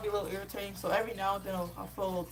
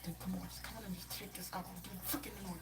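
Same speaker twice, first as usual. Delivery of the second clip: much softer, annoyed grumble to himself; impatient muttering; 13 dB quieter, faster than usual. Retold or imitated. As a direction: grumble it under your breath, fed up. Impatient thoughts while stuck waiting.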